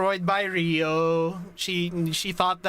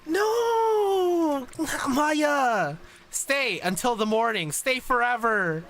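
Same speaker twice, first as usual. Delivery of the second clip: pleading cry; 6 semitones higher, much slower than usual. Acted. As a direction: cry out to stop her leaving. Sensei begs her to stay.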